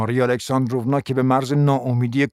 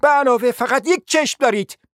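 high-pitched jab; cried out; 11 semitones higher, 3 dB louder than usual. A narrator's reading. Hostess joining the attack on the prince, crying out. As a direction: add the insult with a raised cry. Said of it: "فریاد برآورد".

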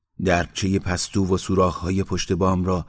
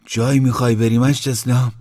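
higher, louder, a touch of fright; alarmed question; 4 semitones higher, 6 dB louder than usual. A narrator's reading. Sick headman asking Islam if he is leaving, mildly alarmed. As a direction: ask with mild alarm. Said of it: "وحشت زده گفت".

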